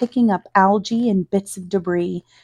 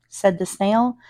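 ordinary delivery, nothing marked

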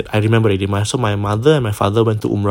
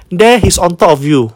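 put-on child's voice; 7 semitones higher, 4 dB louder than usual. Child quoted, voiced high.

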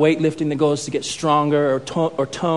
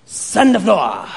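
put-on accented sensei command; short instruction from a stern master; Mister Miyagi. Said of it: "Mister Miyagi's like".

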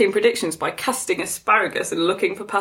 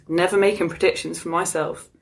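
ordinary talk

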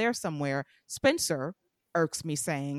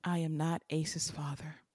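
dramatic whispered confession; mock whisper; 3 semitones lower, 6 dB quieter than usual. Spencer revealing to Trina, dramatic whisper. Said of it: "He said".

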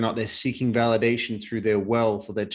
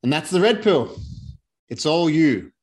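booming declaration; 6 semitones higher, 5 dB louder than usual. Real, not acted. Emphatic climax of talk.

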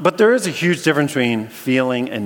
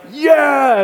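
high, loud victorious cheer; triumphant yeah; 14 semitones higher, 9 dB louder than usual. Retold or imitated. Walker celebrating his crossing, high triumphant whoop. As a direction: whoop it to the crowd. Blondin triumphant at other side.

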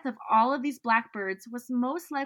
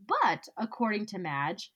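ordinary talk

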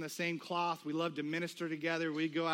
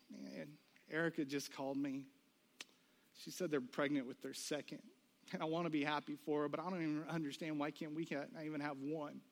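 soft, lowered voice of retold hurt; understated tearful upset; 3 semitones lower, 8 dB quieter than usual. Wife telling husband of friend's pregnancy, quietly upset. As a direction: quietly imitate a wife who has been crying. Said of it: "she's just bawling".

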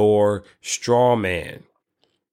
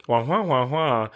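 muffled wah wah talk; 3 semitones higher than usual. Retold or imitated. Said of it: "I hear the Charlie Brown".

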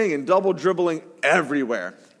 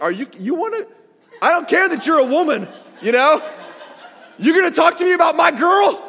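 much higher, louder shouting back; angry retort; 11 semitones higher, 5 dB louder than usual. Retold or imitated. A father shouting at the other team's parent who criticised his daughter. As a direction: stand up for your girl, shouting. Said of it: "And I was like".

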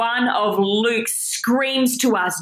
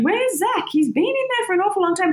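high, quick, impatient questioning; impatient waiting crowd; 9 semitones higher than usual. Acted. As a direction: voice the puzzled people waiting outside, pitched up and hurried. Acting out the impatient people outside.

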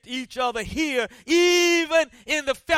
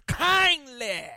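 kindly said firm and drawn out; preacher underscoring a word; stress on kindly. Shown as a demonstration. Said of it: "underscore the word".

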